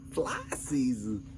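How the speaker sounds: slow, stretched-out puzzled echo; imitated pondering. Husband mimicking his wife falling for the joke, slowly pondering. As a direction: mimic someone seriously mulling it over, slowly. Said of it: "she was seriously giving this some thought".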